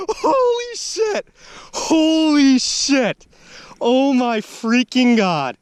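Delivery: very high, slow, drawn-out holy shit; stunned exclamation. Real, not acted. Angler staring at his record bass, amazed. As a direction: drag it out in disbelief. Landing personal best bass.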